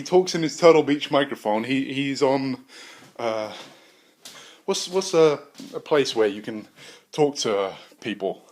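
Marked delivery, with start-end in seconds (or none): none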